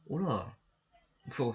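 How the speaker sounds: ordinary talk, nothing marked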